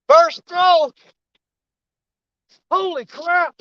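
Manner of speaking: straining, breathless, high and excited; struggling to reel in a heavy fish; fighting a fish. Real, not acted. Fighting a big carp on line.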